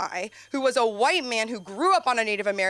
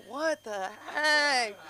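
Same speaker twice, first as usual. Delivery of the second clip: slow, drawn-out baffled mock voice; a slow puzzled mock of the dog owners; 4 dB quieter, much slower than usual. Dog owners gawking at her rat, slow and baffled.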